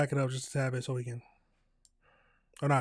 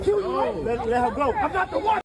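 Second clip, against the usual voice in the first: mimicked clip voice; 11 semitones higher, 5 dB louder than usual. Streamer imitating clip voice.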